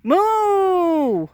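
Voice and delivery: high, loud imitated cow moo; playful cow call. Shown as a demonstration. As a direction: bellow a moo at the cows. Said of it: "Do I not speak moo correctly?".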